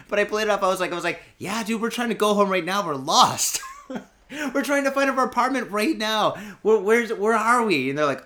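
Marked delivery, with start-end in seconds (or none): 1.4-7.9 high, loud, playful pleading; asking the old couple for directions, playing dumb; hammed-up lost tourist. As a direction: play it off cheerfully.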